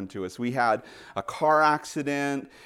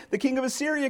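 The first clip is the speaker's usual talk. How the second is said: ordinary talk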